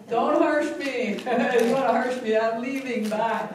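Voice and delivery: high, loud, slow mock whine of "don't harsh my buzz"; hammed-up impression of the touchy friend; mock friend's protest. Mocking her friend who dislikes negativity, loud high drawn-out whine.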